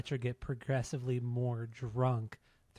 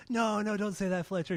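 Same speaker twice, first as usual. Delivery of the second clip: pleading; 8 semitones higher, 3 dB louder than usual. Retold or imitated. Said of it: "they're like".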